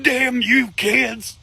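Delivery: high, slow mock old man grumbling; grumpy grandpa. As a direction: growl it like a cranky grandpa. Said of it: "He'd be like".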